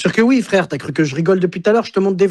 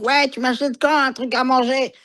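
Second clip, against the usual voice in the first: higher, lightly mocking worker's voice; imitating a worker on the radio; 9 semitones higher than usual. A friend mimicking workers on walkie-talkies, pitched-up mock voice. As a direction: toss off a coworker's radio chatter, pitched up. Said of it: "Tu vas entendre des travailleurs en train de dire".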